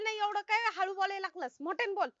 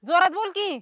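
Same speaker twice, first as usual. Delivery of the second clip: loud demand; 9 dB louder than usual. Acted. Can't hear, demands louder speech.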